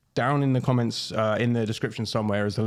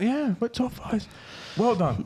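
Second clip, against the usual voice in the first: sarcastic; 11 semitones higher than usual. Acted. Mocking a top-five finish.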